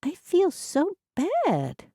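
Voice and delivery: put-on high, slow, sorry old woman's grumble; grumbling. A narrator's reading. Etty grumbling to her sister on the way back to the car. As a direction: drag the words out in a high, glum grumble. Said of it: "Etty grumbled".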